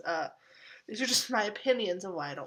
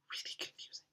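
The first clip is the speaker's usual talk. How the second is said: whispered aside; 15 dB quieter, faster than usual. Real, not acted. Whispered aside on Taxi Driver.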